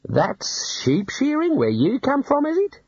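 slow, high, disbelieving question; dumbfounded, rising very high at the end; stunned. A narrator's reading. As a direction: ask it slowly in stunned disbelief. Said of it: "in a stunned voice".

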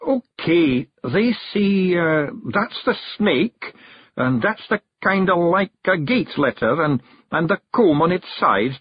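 proud Scots Feegle spelling it out; Rob reading aloud. Acted. Rob reads the chalk letters to the clan, proudly. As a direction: point out each letter like a show-off.

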